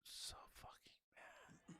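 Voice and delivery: a slow, breathy whisper of anger to himself; seething under the breath. Real, not acted. Drunk streamer mutters to himself.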